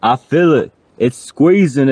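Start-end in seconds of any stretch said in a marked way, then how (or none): none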